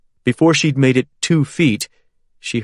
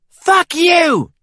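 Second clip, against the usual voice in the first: high, loud furious yell; 5 dB louder than usual. A narrator's reading. Caitlin yells defiance at Harold while shooting. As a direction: yell it as you fire. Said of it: "she yelled".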